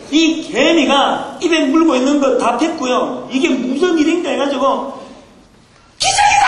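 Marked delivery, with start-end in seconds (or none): 6.0-6.5 very high-pitched ant scream; hammed-up shriek of an amazed little ant. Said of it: "소리를 지르면서". (개미 흉내 내며 높게 내지르는 비명)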